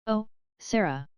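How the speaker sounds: a lightly voiced startled exclaim; mild surprise on the name. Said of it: "she exclaimed".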